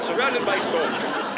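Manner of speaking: ordinary talk, nothing marked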